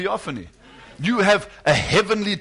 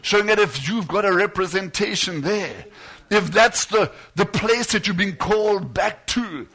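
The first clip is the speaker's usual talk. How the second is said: higher, driving sermon voice rising with fervour; lifted, urgent pulpit delivery; fervent preaching; 4 semitones higher than usual. Acted. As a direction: preach it out to the congregation with mounting passion. Preaching builds up.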